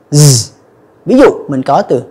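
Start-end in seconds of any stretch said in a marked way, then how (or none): 0.1-0.5 "z" as a low, steady buzz; voiced Z. Shown as a demonstration. Teacher showing the vibrating sound, voiced Z. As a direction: buzz "z" with vibrating vocal cords. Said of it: "các bạn rung lên nó ra âm giờ".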